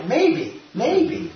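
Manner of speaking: high, rising, playfully doubtful; teasing maybe. Real, not acted. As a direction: dangle the maybe way up high like a tease. Playful doubt after a laugh.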